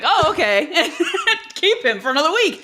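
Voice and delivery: high-pitched, giggly imitation of being won over; mock smitten giving-in. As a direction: play easily charmed, high and laughing.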